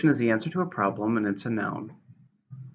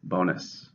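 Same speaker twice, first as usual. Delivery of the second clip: "Bonus" said BO-nus, stress on BO; teacher models "Bonus" as BO-nus; stress on first syllable; 4 semitones lower, 3 dB quieter than usual. Shown as a demonstration. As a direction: lean on BO in "Bonus". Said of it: "stress in the first".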